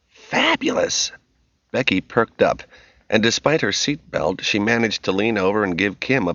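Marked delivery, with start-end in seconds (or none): 0.1-1.1 high, slow, gushing delight; a drawn-out thrilled squeal of a word; excited girl. Acted. Daughter perks up.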